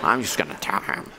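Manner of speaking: low, soft, laughing self-justification; mock online arguer muttering to himself; smug muttering.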